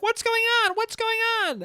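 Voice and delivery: high, startled mom voice; alarmed mom. Retold or imitated. Mom rushing over asking what happened, high and alarmed. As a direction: call out like a worried mother. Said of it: "she's like".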